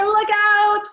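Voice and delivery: high, loud drawn-out yell; shouted warning. Shown as a demonstration. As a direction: shout to warn someone in the way. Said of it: "I would shout it".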